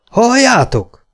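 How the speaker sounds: high, loud yell; shouting. A narrator's reading. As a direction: yell for everyone to listen. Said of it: "ordította Gunnar".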